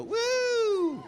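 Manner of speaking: high whooping cheer; excited whoop. Real, not acted. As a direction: let out a big woo. Celebrating a perfect cue.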